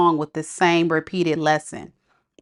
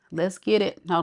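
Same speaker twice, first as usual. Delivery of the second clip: ordinary talk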